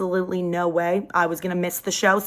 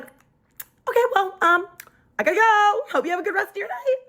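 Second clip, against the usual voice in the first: high, quick awkward goodbye; flustered exit; 11 semitones higher than usual. Retold or imitated. To his brother after a long silent stare, flustered. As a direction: rush out of the room nervously.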